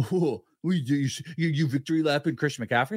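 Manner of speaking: a hint of a sneering critic; lightly mock the cynics; touch of a scoffing fan voice; cynical mock voice. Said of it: "cynical people out there be like".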